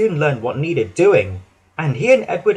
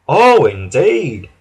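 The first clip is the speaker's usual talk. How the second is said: slow, louder, disapproving; stern "indeed"; 6 dB louder, much slower than usual. Acted. Fat Controller answering the proud engines, slow and stern. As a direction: draw it out with stern disbelief. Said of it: "said the Fat Controller".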